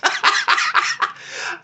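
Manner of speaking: breathless squealing laugh; high wheezing laugh. Real, not acted. Laughing at own Peacemaker impression, high wheezing laugh. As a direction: cackle silently at your own joke.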